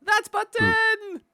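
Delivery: very high, loud, slow yelp; high startled shout. Real, not acted. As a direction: squeal it out in shock. Startled by a button.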